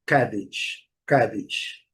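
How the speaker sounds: "cabbage" as CAB-idge, low and falling; low-pitched careful drill of "cabbage"; first syllable stressed. Shown as a demonstration. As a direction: lean on CAB in "cabbage", short weak ending. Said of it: "a separação silábica é diferente".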